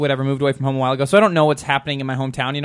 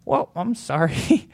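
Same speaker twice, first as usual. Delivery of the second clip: awkward slow apology; 5 dB quieter, much slower than usual. Retold or imitated.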